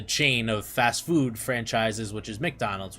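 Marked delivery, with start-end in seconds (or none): 2.4-3.0 "McDonald's" with a short "mick" first syllable; lower, softer "McDonald's" as mick-DON-alds; Mick pronunciation. Shown as a demonstration. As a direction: say "McDonald's" like the burger chain. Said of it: "which is just M C".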